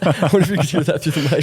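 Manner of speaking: laughing. Real, not acted. Laughing after a loud noise.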